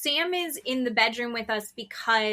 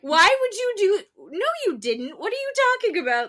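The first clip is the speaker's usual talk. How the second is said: high-pitched, protesting kid imitation; incredulous son; 8 semitones higher, 5 dB louder than usual. Mother retelling her son's reaction to circumcision, incredulous. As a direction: act out a child who cannot believe it. Retold son refusing to believe parents.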